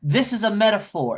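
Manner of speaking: high-pitched theatrical declaration; stage actress. Acted. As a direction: proclaim it from the stage, pitched well up. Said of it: "the actress starts saying".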